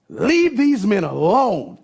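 high, loud, drawn-out preacher's order; emphatic command. Acted. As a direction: insist forcefully, stretching each word out. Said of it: "He was emphatic about it".

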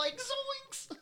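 high squeaky cartoon panic; Shaggy voice. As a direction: yelp like a scared cartoon slacker. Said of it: "Like Scoob".

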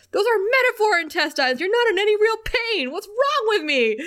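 high, loud, frantic mock-Anya voice; exasperated Anya. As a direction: play Anya losing it, way up in the voice. Voicing Anya's exasperated outburst.

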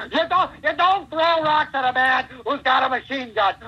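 high, loud wrestler promo voice; Piper. Acted. Wrestler shooting a short video by bagpipers, high ranting promo. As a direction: rant high and hard, drawing out the last words. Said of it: "thinking about Piper, I start".

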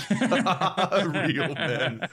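laughing through the words; chuckling repeat. Real, not acted.

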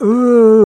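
high loud moan; dream moan. Retold or imitated. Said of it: "hearing the sound of, like".

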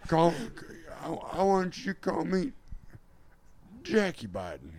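halting, high put-on politician; Joe Biden. Politician coming out, halting high impression. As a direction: announce a new name in mock earnest.

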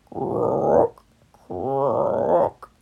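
deep rising croaks; low frog croak. Acted. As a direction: croak back from the ditch. Frog answers from ditch.

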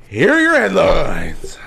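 slow, high-starting announcer call with a burp-like sound; burpy announcer. Pretend. Host presents the game's headlines, slow burpy announcer voice. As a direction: draw it out as if burping mid-announcement. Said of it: "Did you burp during that?".

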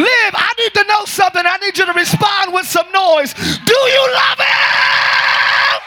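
building to a high, long-held cry; rousing preacher's shout. Real, not acted. Preacher demanding noise from the congregation, building shout. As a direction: call out and stretch the final words.

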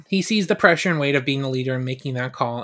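ordinary delivery

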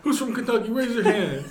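high, loud barked orders, half laughing; mock drill sergeant shouting; drill instructor. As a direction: bark commands at a recruit. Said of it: "if you're a D I".

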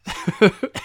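loud, high burst of laughter; amused laugh. Real, not acted. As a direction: burst out laughing mid-thought. Amused at his own point.

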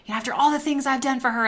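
replaying her own hurt disbelief; how could she, huffed out quickly; indignant inner voice. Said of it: "I was like".

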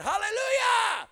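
long, high shouted Hallelujah. Real, not acted. Preacher shouting praise to the congregation. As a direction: shout it out in triumph.

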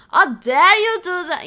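put-on loud, high, intense Russian accent; hammy stern Russian voice. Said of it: "What was that accent?".

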